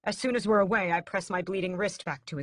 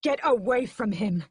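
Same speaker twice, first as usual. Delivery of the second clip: higher, louder scream; 5 semitones higher, 7 dB louder than usual. A narrator's reading. Brother screaming at mother to get away. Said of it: "I scream".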